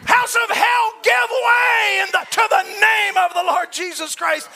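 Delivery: full-voiced revival command; shouted rebuke. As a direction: bellow the command at the walls.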